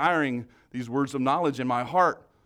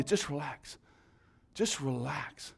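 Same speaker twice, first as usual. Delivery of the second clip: softer, slowed right down, easing off; calm soothing; 8 dB quieter, slower than usual. Shown as a demonstration. Preacher calming nervous listeners, soft slow reassurance. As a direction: let the words settle like an exhale. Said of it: "I'm just telling you".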